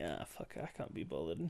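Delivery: usual speaking voice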